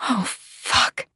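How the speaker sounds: breathy, gasped cry; hushed outcry of pleasure. A narrator's reading. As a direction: cry out under the breath, overcome. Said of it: "and I cry out".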